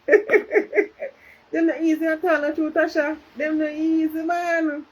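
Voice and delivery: laughing, slow Jamaican patois. Pretend. Reading a comment in patois.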